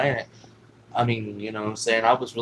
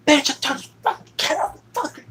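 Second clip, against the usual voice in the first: much higher, louder mock shriek; shrieking aunt; 5 dB louder than usual. Aunt screaming at niece while beating her, mock shriek.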